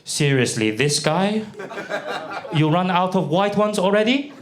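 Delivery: imitating a let-down exchange student; mock disappointment. Disappointed Chinese student seeing his exchange partner, mock dismay. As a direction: rise into an incredulous question. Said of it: "he was like".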